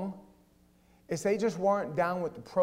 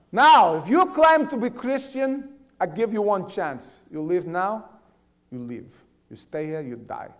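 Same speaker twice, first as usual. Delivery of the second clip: put-on Russian accent; 4 semitones higher, 9 dB louder, slower than usual. Gunman's ultimatum in Russian accent.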